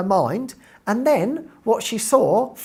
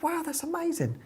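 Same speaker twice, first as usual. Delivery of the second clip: much higher, thrilled gush; excited; 10 semitones higher, 10 dB quieter than usual. Retold or imitated. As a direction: squeal it out with delight. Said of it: "And I'm like".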